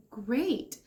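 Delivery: ordinary talk, nothing marked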